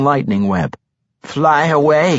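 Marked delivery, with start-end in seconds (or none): 1.2-2.2 raised, urgent warning call; restrained narrated cry of alarm; mild fearful cry. A narrator's reading. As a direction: cry out a warning with a touch of fear. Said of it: "she heard Ephron cry to her".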